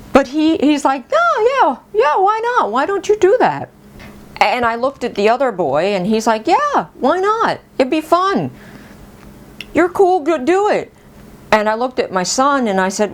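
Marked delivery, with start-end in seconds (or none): 1.1-3.7 put-on high-pitched, keen kid voice; eager boy. Boy eagerly urging mom to join. 6.5-10.9 put-on high kid voice, easygoing urging; encouraging boy. Second boy encouraging her.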